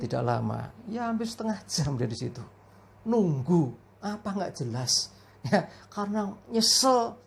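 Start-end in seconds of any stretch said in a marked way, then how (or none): none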